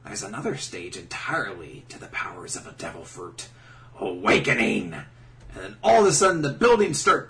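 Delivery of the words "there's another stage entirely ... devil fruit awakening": lightly voiced, softer menacing lecture; a hint of a villain, low and measured; Doflamingo. Doflamingo explaining awakening to Luffy, lightly acted villain voice. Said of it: "Doflamingo explains".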